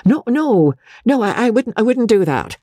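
put-on high, stammering young doctor; flustered pleading, pitched well up; alarmed protest. A narrator's reading. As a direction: object hastily, tripping over the words. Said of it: "The young doctor raised a protesting palm".

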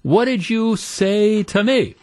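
high, drawn-out mock outraged yell; stretched-out mock screaming in a high pitch; retold scream. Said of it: "goes ballistic and starts screaming".

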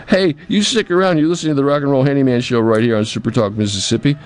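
high, fast radio sign-off; radio host announcer. Acted. Host telling listeners to stick around, radio announcer style. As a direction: call out to listeners as you head to a break.

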